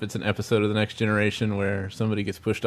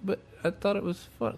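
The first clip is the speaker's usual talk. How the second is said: a light, high, quiet robot-innocent voice; innocent Data; 6 semitones higher, 12 dB quieter than usual. Retold or imitated. Data defending the push to the crew, lightly voiced innocent android. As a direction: play it like the android doesn't get the joke. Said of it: "he's like".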